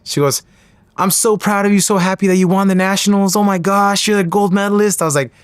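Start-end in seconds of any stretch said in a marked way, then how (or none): 1.0-5.0 high, excited run of praise; imitating gushing sister. Sister congratulating her brother on nationals, gushing. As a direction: gush proudly in a higher voice.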